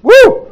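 high, loud whoop of joy; excited whoop. Real, not acted. Preacher cheers the congregation, high loud whoop. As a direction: let out a big celebratory woo. After blowing the horn.

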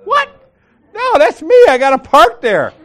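very high squeal, then loud protest; mock startled protest. Woman explaining her parked van, mock startled protest. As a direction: imitate a flabbergasted woman setting it straight.

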